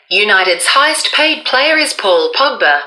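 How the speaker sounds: ordinary delivery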